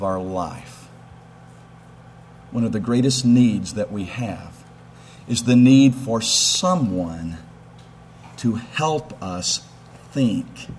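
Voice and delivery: low, hushed and slow; soft preaching. Real, not acted. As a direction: draw them in quietly. Preacher drops to soft intensity.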